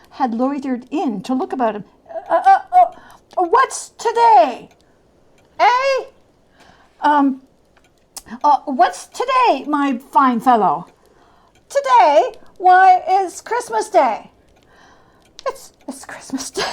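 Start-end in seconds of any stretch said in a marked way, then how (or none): 2.3-10.9 high, eager calling out; excited old man calling. Acted. Scrooge calling from the window to a boy, eager and high. 11.7-14.3 high, lightly voiced street boy; cheeky boy. The boy in the street answering Scrooge, lightly voiced and cheeky. 15.5-16.6 breathless whispered wonder; awed whisper. Acted. Scrooge realizing he hasn't missed Christmas, whispered awe.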